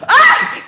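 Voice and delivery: very high loud laughing squeal; excited squeal. Real, not acted. Dreaming of space men, excited squeal. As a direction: squeal with delight.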